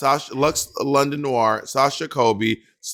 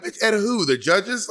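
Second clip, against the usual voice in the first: incredulous sass; 7 semitones higher than usual. Retold or imitated. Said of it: "I'm like".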